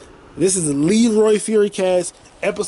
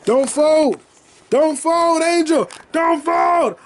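pleading cry; 8 semitones higher, slower than usual. Real, not acted. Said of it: "parting cry to Angel Reese".

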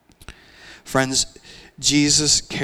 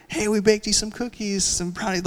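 cheery neighbor; 9 semitones higher than usual. Retold or imitated. Neighbor dad brings the cookies.

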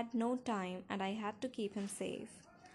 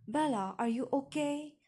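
raised, louder worried questioning; frightened; 5 semitones higher, 5 dB louder than usual. A narrator's reading. Father pounding on his daughter's door, frightened. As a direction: call out in alarm. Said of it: "His voice was frightened".

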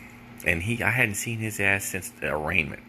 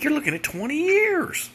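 much higher, louder, hammed-up lawyer mock; 4 dB louder than usual. Comedian mocks his public defender's twenty-year warning, high and mocking. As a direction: make the lawyer sound absurd. Lawyer mocked in retold story.

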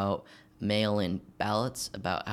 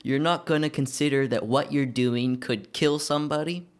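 raised, higher mock yell; louder, pitched-up retelling of someone yelling; imitating yelling; 6 semitones higher, 6 dB louder than usual. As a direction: act out an outraged scolding yell. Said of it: "yells at him".

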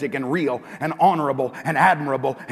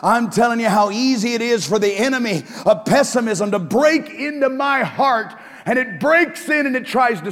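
raised, ringing sermon delivery; preaching; 7 semitones higher, 7 dB louder than usual. Acted. Pastor lifting the congregation, preaching full out. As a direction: preach it out to the church with force.

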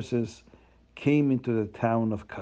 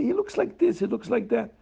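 eager rich man; 10 semitones higher than usual. Acted. Rich man describes the poor man.

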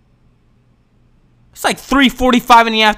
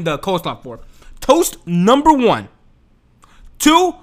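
usual speaking voice

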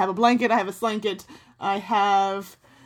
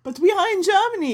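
excited; 10 semitones higher, 5 dB louder than usual. Pretend. Excited exclamation.

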